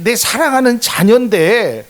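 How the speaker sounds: preacher voicing God's indignation, building; rising indignation. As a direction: press the point harder. God defending his child.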